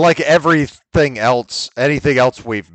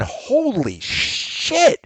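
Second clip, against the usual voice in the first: drawn-out, higher-pitched amazement; amazed exclamation; 12 semitones higher, much slower than usual. Real, not acted. Telling cohosts about delving into the catalog, amazed exclamation. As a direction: stretch it right out, astonished.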